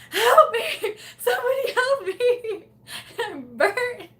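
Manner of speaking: high, hammed-up wail with laughter; mock cry for help. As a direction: plead desperately, playing it for laughs.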